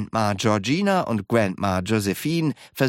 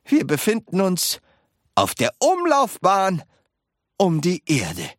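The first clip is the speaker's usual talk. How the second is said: high, loud reveal with pauses; dramatic announcement; 9 semitones higher, 3 dB louder than usual. A narrator's reading. Wonka revealing the orbit to his friends, dramatic announcement. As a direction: announce it grandly, phrase by phrase.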